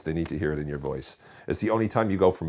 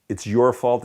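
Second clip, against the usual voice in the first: "your" punched higher and louder; accuse, hitting "your" hard; stress on "your"; 5 semitones higher, 6 dB louder, slower than usual. Shown as a demonstration. Repeats the most effective blaming take.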